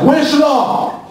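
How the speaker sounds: high, slow drawn-out call for Shalom; mother hollering for her son. Retold or imitated. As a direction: call him like a fed-up mother down the hall.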